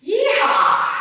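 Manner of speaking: high loud yeehaw whoop; cowboy whoop. Real, not acted. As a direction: whoop like a rodeo rider. Cheering during workout.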